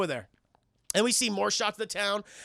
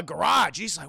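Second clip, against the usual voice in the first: normal talking voice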